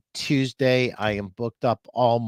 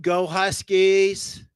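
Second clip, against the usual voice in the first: team cheer; 8 semitones higher, 5 dB louder, much slower than usual. Real, not acted.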